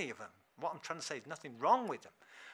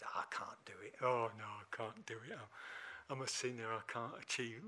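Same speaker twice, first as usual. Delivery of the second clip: softer, mock-helpless moan; mock defeatism; 4 semitones lower, 6 dB quieter than usual. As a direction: act out giving up, low and subdued. Acting out self-limiting excuses.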